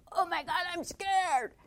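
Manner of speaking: high, loud mock alarm; mock panic. Shown as a demonstration. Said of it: "It's not like".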